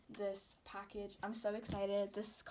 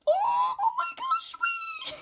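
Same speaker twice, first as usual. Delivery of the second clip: very high, loud scream of joy; thrilled shriek; 10 dB louder than usual. Real, not acted. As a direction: scream with sudden delight. Reveal of a card.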